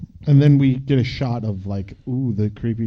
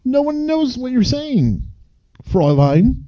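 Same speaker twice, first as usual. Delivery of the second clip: mock scolding; 12 semitones higher, slower than usual. Teasing the German tourist.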